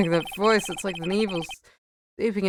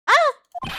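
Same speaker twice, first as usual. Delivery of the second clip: startled gasp; 6 dB louder than usual. Acted. Startled by hidden discovery.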